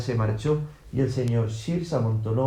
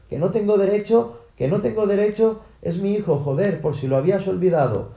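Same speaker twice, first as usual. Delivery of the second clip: high, indignant shouting; outraged shout; 8 semitones higher, 3 dB louder than usual. A narrator's reading. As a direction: throw it back in outrage. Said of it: "Y padre gritó".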